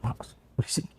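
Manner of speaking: whispered warning; acted-out whisper, barely audible; hushed shushing. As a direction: hush them in an urgent whisper. Shushing partner so neighbours won't hear.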